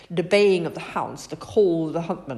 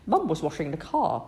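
ordinary delivery

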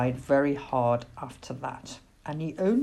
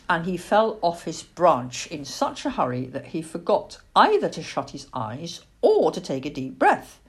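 ordinary delivery

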